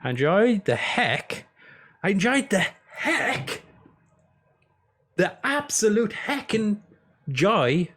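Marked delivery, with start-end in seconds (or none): 2.0-7.9 high, drawn-out silly voice; hammed-up enthusiasm. Streamer overselling how much he enjoyed a game, hammed-up. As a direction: stretch it out, pitched way up.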